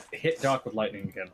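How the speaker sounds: usual speaking voice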